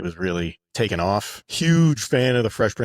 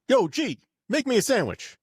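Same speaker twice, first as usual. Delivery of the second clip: much higher cocky Will voice; mock Will; 13 semitones higher than usual. Will ordering the butler in a cocky high voice. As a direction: call out like a cheeky teen. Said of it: "He called him G".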